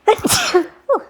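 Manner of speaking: sudden loud sneeze with a whew; sneezing. Real, not acted. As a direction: let a real sneeze burst out. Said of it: "Excuse me, I have to sneeze".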